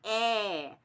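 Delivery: drawn-out, wide-open "é" sound. Shown as a demonstration. Teacher showing pupils how the letter E sounds, open and drawn out "é". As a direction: hold a long, open "é". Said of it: "se pronuncia é".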